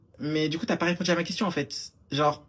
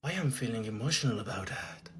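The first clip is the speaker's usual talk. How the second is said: imitating Cynthia; 7 semitones lower, 8 dB quieter than usual. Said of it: "elle répond".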